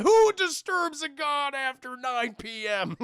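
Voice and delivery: loud, high, drawn-out mock-divine boom; hammed-up god making a grand demand; pompous angry god. Disturbed god challenges late visitors, pompous and booming.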